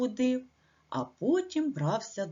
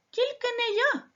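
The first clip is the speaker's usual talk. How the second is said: much higher squeaky mouse cry. Acted. Mouse Verts refuses the rooster, squeaky high cry. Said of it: "закричав Верть".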